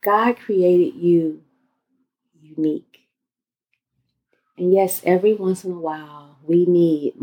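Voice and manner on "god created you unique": guided meditation. Acted. Leads guided breathing exercise.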